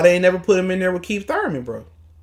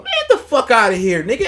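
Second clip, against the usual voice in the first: higher, laughing scoff; laughing dismissal; 6 semitones higher, 3 dB louder than usual. Real, not acted. Brushing off the pundit's claim with a laugh. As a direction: laugh him off.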